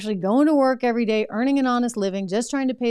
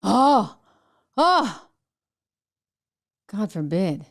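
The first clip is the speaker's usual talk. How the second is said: drawn-out mock gasps, then a low deadpan; sarcastic pearl-clutching, dropping low at the end; mock horror; 3 semitones lower, slower than usual. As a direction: feign dismay with big pauses. Sarcastic reaction to the clip.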